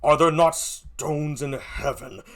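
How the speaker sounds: normal talking voice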